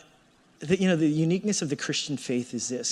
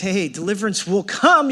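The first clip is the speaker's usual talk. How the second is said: ringing promise; 4 semitones higher, 7 dB louder than usual. Acted. Preacher voicing God's promise.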